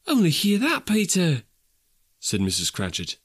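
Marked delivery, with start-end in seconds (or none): 0.1-1.4 high-pitched exclamation to a son; delighted mother. Acted. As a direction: call out the good news, pitched well up.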